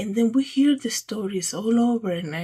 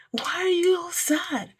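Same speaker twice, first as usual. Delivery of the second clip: whispered much higher, in disbelief; high incredulous whisper; 8 semitones higher than usual. Acted. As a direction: breathe it out high, baffled. Puzzled rhetorical question to listeners.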